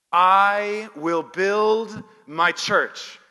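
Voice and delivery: loud, slow and drawn out; stretched, ringing proclamation of a promise; emphatic declaration. Real, not acted. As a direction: proclaim it slowly at full voice, as one phrase. Preacher declares the key verse.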